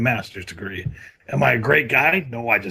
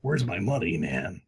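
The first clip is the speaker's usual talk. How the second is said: ordinary delivery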